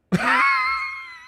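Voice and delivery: sudden high shrieking laugh; helpless laughing fit; big burst of laughter. Real, not acted. Laughing at bad movie dub.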